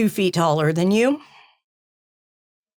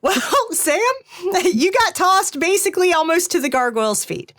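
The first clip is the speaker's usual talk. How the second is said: amused, higher-pitched laughing delivery; 8 semitones higher than usual. Real, not acted. Game master tells Sam he got tossed, laughing. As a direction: talk through real laughter.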